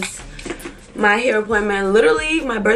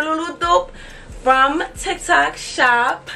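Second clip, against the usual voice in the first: ordinary talk, nothing marked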